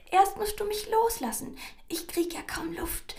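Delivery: high, squeezed-out mouse squeak; gasping mouse. A narrator's reading. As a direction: protest as a mouse short of air. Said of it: "japste die Maus".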